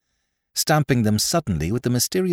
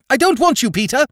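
much higher and louder, dont hammered; hysterical shout; 6 dB louder than usual. Acted. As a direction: scream the refusal at him. Sister screams at brother.

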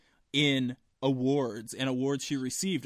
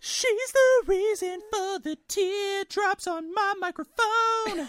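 slow, high falsetto singing-style line; man doing a woman's voice; 6 dB louder, slower than usual. Pretend. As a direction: croon it way up in falsetto. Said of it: "Why do you go so falsetto?".

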